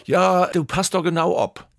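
höher, lauter, begeistert; eager pitch. Retold or imitated. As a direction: sell it with enthusiasm. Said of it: "denke, was ist das denn".

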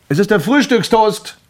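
loud, high announcement; calling out. Acted. Grimpel announces breakfast toast through the letterbox, loud high call. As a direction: proclaim it through a closed door.

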